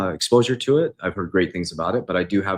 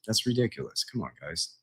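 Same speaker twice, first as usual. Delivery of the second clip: ordinary talk, nothing marked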